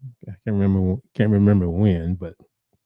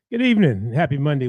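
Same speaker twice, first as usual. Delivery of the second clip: upbeat greeting; 6 semitones higher than usual. Real, not acted. Radio host greeting listeners.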